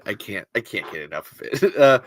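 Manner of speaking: ordinary delivery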